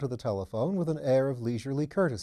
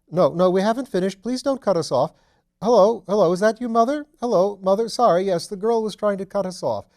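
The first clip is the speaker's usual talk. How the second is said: higher, much louder urgent phone shouting; 8 semitones higher, 9 dB louder than usual. Acted. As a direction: yell to keep the line alive. Shouting into a bad phone line.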